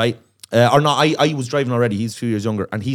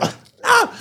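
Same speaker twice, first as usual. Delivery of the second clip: defiant refusal; 3 dB louder than usual. Retold or imitated. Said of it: "He's like".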